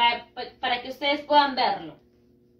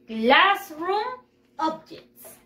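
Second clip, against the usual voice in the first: very slow, word by word for pupils; modelo para repetir; 3 semitones higher, much slower than usual. Shown as a demonstration. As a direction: stretch out "Classroom objects" for repeating. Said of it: "Repeat after me". (modelo lento para repetir)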